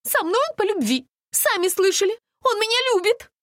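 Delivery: very high, loud insistence; frantic, wailing denial; hysterics. A narrator's reading. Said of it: "Настя уже впала в истерику".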